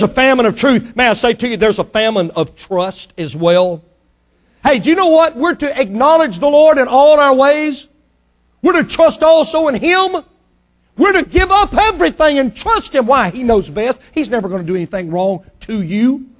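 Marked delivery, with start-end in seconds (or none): 4.6-13.1 high, loud exhortation; preaching to the congregation with rising fervor. Acted. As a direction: call them to trust, full force.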